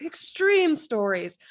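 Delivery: monster truck voice. Acted. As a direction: roll it out like a truck rally. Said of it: "like, monster truck voice".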